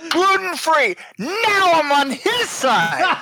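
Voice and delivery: high, slow indignant declaration; mock outrage. Host switching to the brother's side, mock outrage. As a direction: announce betrayal with comic exasperation.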